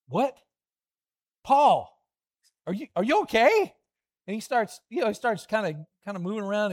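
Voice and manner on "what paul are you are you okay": higher, louder startled call to Paul; alarmed call. Acted. Believers calling to Paul as he stirs, startled and louder. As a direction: check on him, suddenly hopeful.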